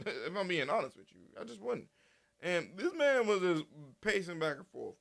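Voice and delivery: ordinary talk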